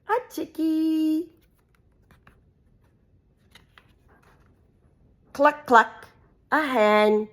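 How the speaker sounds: lively sing-song picture-book reading; reading to children. Acted. Mum reading a farm book to her kids, animated. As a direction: read it out brightly for little ones.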